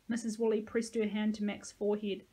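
usual speaking voice

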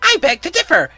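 very high, loud cartoon boy; squeaky-high boyish shout. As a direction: pipe up loudly like a cartoon kid. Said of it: "came the annoying voice".

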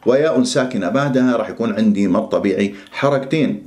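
ordinary delivery

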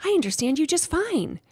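high, insistent hamster voice; frantic squeak. A narrator's reading. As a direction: squeak it urgently to be understood. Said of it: "I frantically squeaked".

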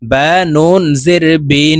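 slow spelling, the n of "বিন" held long in the nose; nasal ghunnah. Shown as a demonstration. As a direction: draw out "বিন" on a nasal hum. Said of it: "নাকের বাসি থেকে তিন second পরিমাণ গুন্নাহ করে ধরে রাখব". ("বিন"-এর নুন নাকে ধরে লম্বা গুন্নাহ)

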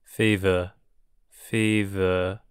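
normal talking voice

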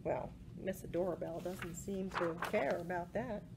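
usual speaking voice

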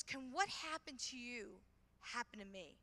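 soft, slow, pleading; a quiet hopeful plea; hushed plea. Retold or imitated. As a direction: ask it almost under breath.

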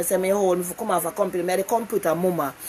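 usual speaking voice

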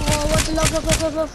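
loud panicked urging to run; being chased. Real, not acted. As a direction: yell run while fleeing. Fleeing enemy in game.

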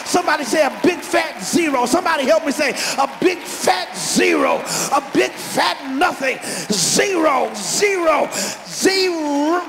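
loud, rising sermon cadence; shouted gospel preaching; preaching at full force. Real, not acted. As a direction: drive the point home to the congregation.

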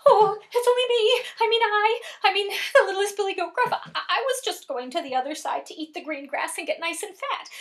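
high, nervous little goat; littlest goat voice. Acted. Littlest goat answering the troll on the bridge, high and nervous. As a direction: stammer it high as a small scared goat.

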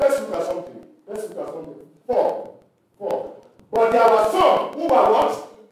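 ordinary delivery

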